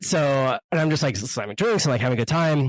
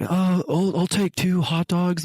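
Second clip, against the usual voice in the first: lightly voiced, higher-pitched husband; a hint of a hesitant customer; imitated husband ordering; 6 semitones higher, slower than usual. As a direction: order politely, slowly and higher. Voicing husband at hot dog stand.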